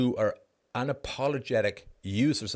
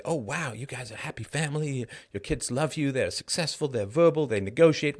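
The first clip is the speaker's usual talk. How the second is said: higher-pitched mock wowed praise; voicing impressed onlookers, pitched up; imitated admiration; 5 semitones higher than usual. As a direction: gush lightly like an impressed onlooker.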